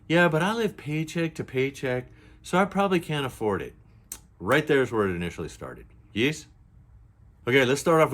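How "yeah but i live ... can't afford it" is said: high, airy mock of the influencer. Quoting the influencer who lives paycheck to paycheck, mock-high. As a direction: play her up, light and breezy. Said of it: "She goes".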